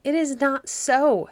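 a queen's angry denial, said slowly. A narrator's reading. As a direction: snap back at him, slow and stung. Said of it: "she said angrily".

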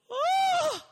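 loud, high "Ah!" yelp; sharp yelp. Acted. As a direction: blurt out a startled cry. Mock outburst.

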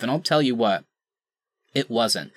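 ordinary talk

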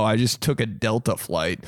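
ordinary delivery

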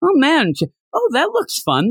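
very high-pitched, wistful-sounding admiration; admiring onlooker. Retold or imitated. Onlooker watching them have happy dreams, high admiring voice.

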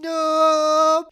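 very loud, drawn-out crying no; despairing wail. Acted. Child learns the toy list is cut, despairing wail. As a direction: howl it out in anguish.

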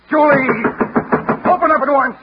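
loud, high furious yell; demanding she open up, shouting; angry shout. Acted. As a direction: bang out an order through the door. Shouting at a locked door.

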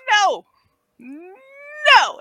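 high loud cry of protest; shouting no. Acted. Character cries out in protest.

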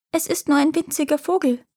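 high little bird-like pipe; high clear voice. A narrator's reading. Boy on the roof to the giant, high-voiced. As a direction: answer in a small, high-pitched voice. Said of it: "antwortete der Junge mit heller Stimme".

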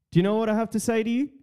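fast rhetorical. Real, not acted. Building to telling them off.

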